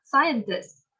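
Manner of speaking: "Scientist" with SCI punched; stress on first syllable. Shown as a demonstration. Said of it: "thì mình sẽ nhấn âm nhất".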